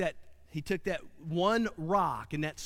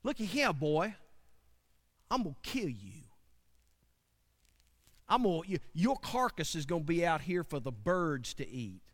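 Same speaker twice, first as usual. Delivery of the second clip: Goliath threatening; 4 semitones higher than usual. Acted. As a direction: menace the boy from above. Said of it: "Goliath's like".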